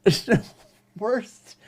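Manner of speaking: high, laughing through the words. Real, not acted. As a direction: crack up helplessly while talking. Cracking up at his own impression.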